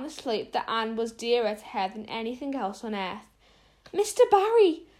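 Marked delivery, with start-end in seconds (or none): 3.9-4.9 higher, louder frightened gasp; gasped in fear. A narrator's reading. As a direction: rush to Mister Barry in fear for Anne. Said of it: "she gasped".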